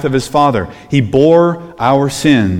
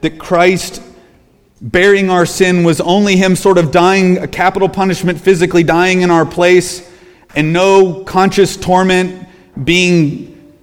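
preaching at full force; 7 semitones higher than usual. Acted. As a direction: press the point on false teachers. Preaching rebuke.

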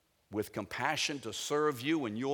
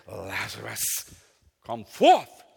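loud commanding call; 8 dB louder, slower than usual. Acted. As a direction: summon him forth, building to a loud cry. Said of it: "And in a loud voice".

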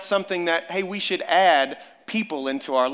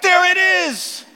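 high, loud, drawn-out cry of discovery; triumphant shout; 11 dB louder, slower than usual. Retold or imitated. Father spotting the lost hamster at dawn, high excited shout. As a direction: yell it like you finally found it. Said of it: "And I'm like".

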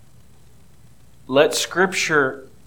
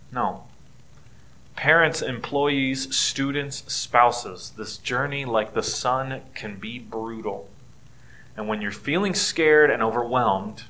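usual speaking voice

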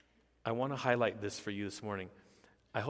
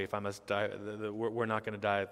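ordinary talk

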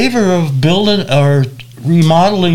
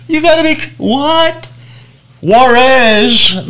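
stunned disbelief; 9 semitones higher than usual. Retold or imitated. Realizing they cross into Mexico.

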